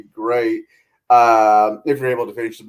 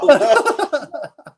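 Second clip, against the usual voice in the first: high laugh. Real, not acted. Laughs after his own story.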